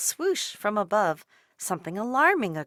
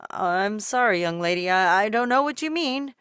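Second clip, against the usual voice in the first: louder, baffled mailman; perplexed mailman; 7 dB louder than usual. A narrator's reading. Mailman puzzled by Dolores, louder. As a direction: stumble in confusion. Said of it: "he just seemed perplexed".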